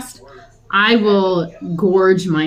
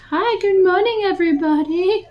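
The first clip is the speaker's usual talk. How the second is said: high, bright hello to the whole audience; cheery morning show greeting; 10 semitones higher than usual. Retold or imitated. Vlogger imitating morning show host greeting viewers, high and cheery. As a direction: sing out good morning like a TV host. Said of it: "I didn't get to be like".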